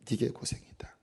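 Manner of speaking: hushed, breathy whisper of a rebuke. Acted. The Lord rebuking Saul, whispered. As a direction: drop to a whisper to close the warning.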